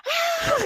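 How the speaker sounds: a high short shriek; startled scream. Retold or imitated. Caught on the toilet by a boy, high shriek. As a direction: shriek as the door opens. Boy opened door on her.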